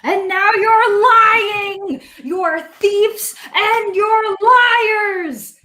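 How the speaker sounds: high, drawn-out goblin wail of accusation; screaming goblin. Acted. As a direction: screech slowly in outrage at the thieves. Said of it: "it starts screaming".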